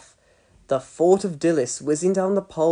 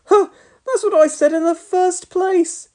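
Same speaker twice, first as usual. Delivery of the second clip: put-on whiny boy; 13 semitones higher, 5 dB louder than usual. A narrator's reading. Boy whines told-you-so.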